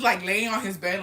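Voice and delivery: usual speaking voice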